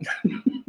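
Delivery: soft, high tail of laughter; trailing laugh. Real, not acted. Guest after confessing his bad past, laughing softly. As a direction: let the laughter die away. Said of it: "I'm laughing about that".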